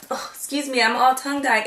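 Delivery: normal talking voice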